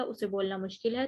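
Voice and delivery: usual speaking voice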